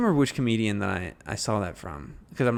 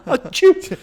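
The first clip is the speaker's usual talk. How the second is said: high, loud fake achoo; fake sneeze; 10 dB louder than usual. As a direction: sneeze it out playfully. Fakes a sneeze mid-joke.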